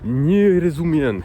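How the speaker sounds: slow careful "Nie rozumien"; slow Polish demo. Shown as a demonstration. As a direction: stretch out "Nie rozumien" like a teacher. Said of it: "tu vas dire".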